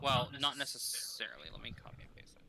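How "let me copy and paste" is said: whispering. Real, not acted. GM muttering to himself.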